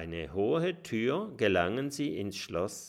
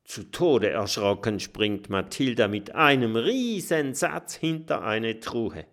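ordinary delivery

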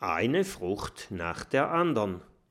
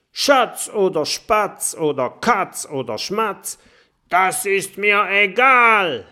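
much higher and much louder roar; roaring tiger; 9 semitones higher, 11 dB louder than usual. A narrator's reading. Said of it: "brüllt der Tiger". (Tiger brüllt den Drachen wütend an)

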